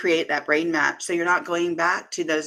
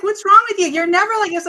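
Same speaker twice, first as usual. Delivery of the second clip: high, louder imitation of a worried husband; 14 semitones higher, 8 dB louder, faster than usual. Guest imitating her husband checking on her, high and loud. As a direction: mimic him fussing over you, loud and high. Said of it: "He's like".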